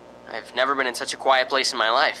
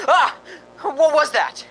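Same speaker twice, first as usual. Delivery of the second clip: sudden high yelp of fright; startled cry; 14 semitones higher, 6 dB louder, slower than usual. Acted. Smith startled by a bat in the room, yelping. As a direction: jump at something flying past.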